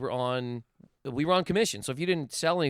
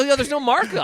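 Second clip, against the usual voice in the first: emphatic retort; 9 semitones higher, 6 dB louder than usual. Retold or imitated. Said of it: "I go".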